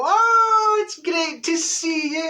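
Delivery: soaring, loud, drawn-out gushing greeting; fake delight. Greeting someone not seen for a while, fake delight. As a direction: squeal a big put-on welcome. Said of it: "put on your smile and go".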